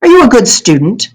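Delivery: sneering. Acted. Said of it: "He seemed to be sneering".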